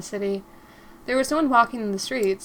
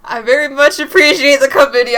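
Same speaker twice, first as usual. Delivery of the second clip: loud, hammed-up British character voice; British accent; 4 semitones higher, 12 dB louder than usual. Reginald thanking companion for coming, loud attempted British accent. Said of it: "I don't think it was British".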